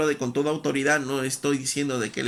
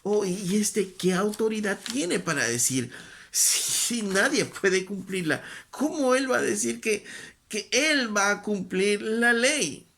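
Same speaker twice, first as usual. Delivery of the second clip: voiced higher, a mildly scoffing doubter; lightly voiced incredulous crowd; sceptical listeners; 7 semitones higher than usual. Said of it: "Y entonces obviamente decía".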